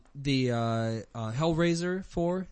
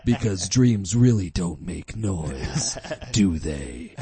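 trailer voice; 6 semitones lower, 5 dB louder, much slower than usual. Acted. Mock tagline follow-up, laughing.

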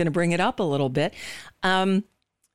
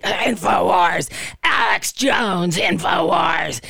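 guttural throaty shout, slow and drawn out; mock the radio host's gravelly bark; "InfoWars" growled from the throat, shouted and dragged out; Alex Jones impression; slower than usual. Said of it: "say it with that guttural throat thing".

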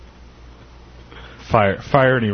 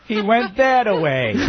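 silly cartoon voice; 10 semitones higher than usual. High far put-on voice.

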